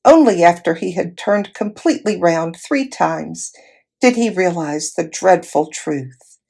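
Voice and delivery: ordinary delivery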